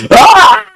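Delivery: high-pitched yelp of exasperation; exasperated high "ah"; frustrated scream. Real, not acted. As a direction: let out a short venting scream. Venting frustration after rant.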